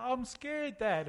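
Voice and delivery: high, mock-frightened little girl on the ladder; scared little girl. A father imitating his scared daughter on the ladder, high and small. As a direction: lightly voice a frightened child. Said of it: "And she's like".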